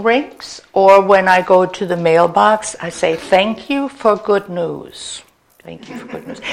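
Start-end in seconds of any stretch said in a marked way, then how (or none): 5.6-6.4 very soft, under the breath; whispered affirmation. Shown as a demonstration. Said to oneself as the phone rings, whispered. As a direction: repeat it privately in a whisper.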